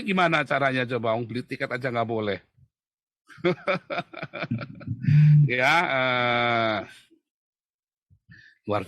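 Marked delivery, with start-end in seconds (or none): 3.4-5.4 loud burst of laughter; hearty laugh. Real, not acted. Speaker amused at being refused a ticket, loud laughing. Laughs at his own predicament.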